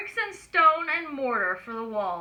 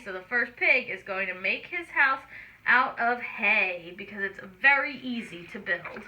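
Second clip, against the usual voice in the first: plain reading voice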